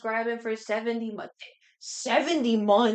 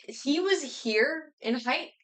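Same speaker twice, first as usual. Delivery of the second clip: slow emphasis; 6 semitones higher, much slower than usual. Retold or imitated. Showing plumbers' height.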